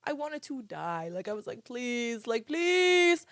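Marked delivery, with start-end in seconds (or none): 1.6-3.3 drawn-out plea, rising high and loud on the last; pleading. Retold or imitated. Exhausted vlogger retelling wanting to die, mock pleading. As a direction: beg with a stretched, climbing please. Retold begging while exhausted.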